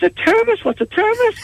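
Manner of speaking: very high, puzzled asking; baffled question. Acted. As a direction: squeak out total confusion. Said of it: "And he goes".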